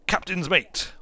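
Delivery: normal talking voice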